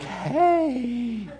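high, eager dog greeting; bright yelp of a happy dog. Retold or imitated. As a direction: play an excited puppy saying hi. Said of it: "They're just like".

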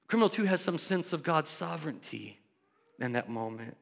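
ordinary talk, nothing marked

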